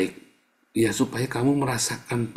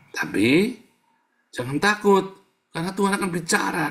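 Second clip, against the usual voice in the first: rising exhortation; 9 semitones higher than usual. Real, not acted. Preacher reassuring congregation.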